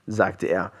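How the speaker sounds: usual speaking voice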